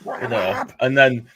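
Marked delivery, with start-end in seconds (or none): 0.0-0.2 very high-pitched imitation of a screaming fan; strained, high yell at the linesman, not full volume; mock scream. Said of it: "you're screaming at him".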